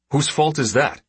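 put-on indignant old man's comeback; sharp retort. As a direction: throw the blame straight back. Father retorts to his son.

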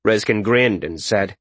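plain reading voice

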